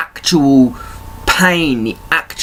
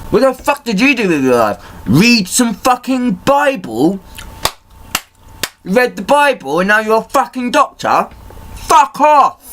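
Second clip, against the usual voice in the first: higher, rising angry tirade ending in a shout; higher furious outburst building to a shouted fuck off; furious rant; 10 semitones higher than usual. Real, not acted. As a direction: tear into them, building to a yell. Angry rant at self-styled experts.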